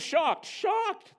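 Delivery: high, loud burst of mock outrage; hammed-up indignant disbelief; mock shock. A narrator's reading. Narrator voicing daughter's shock at disbelief, hammed-up outrage.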